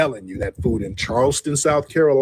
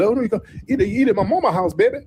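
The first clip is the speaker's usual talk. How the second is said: local folks inviting; 7 semitones higher, faster than usual. Retold or imitated. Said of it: "That's why they always tell you".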